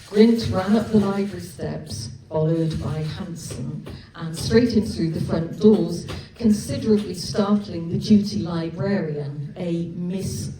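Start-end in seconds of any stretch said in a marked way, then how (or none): none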